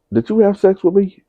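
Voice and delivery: mock a stunned mother; shocked mother. Mother confronting son.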